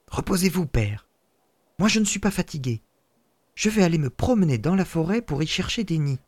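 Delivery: eager son. Acted. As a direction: brush off the tiredness brightly.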